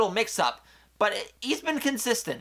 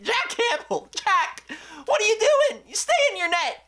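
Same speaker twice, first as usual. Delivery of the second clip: yelling at goalie; 14 semitones higher than usual. Real, not acted. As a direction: scold the goalie for leaving his net. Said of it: "I'm sorry for yelling at you".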